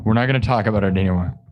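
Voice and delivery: normal talking voice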